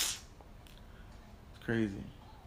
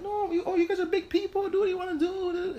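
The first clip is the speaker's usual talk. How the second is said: mock friend. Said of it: "he's like".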